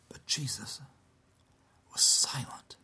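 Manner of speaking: whispered narration; hushed whisper. A narrator's reading. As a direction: drop to a whisper for the silence. Narrator hushes at Jesus' silence.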